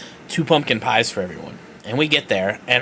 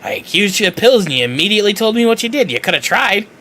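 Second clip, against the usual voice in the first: imitating Brenda; 8 semitones higher, 4 dB louder, faster than usual. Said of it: "She goes".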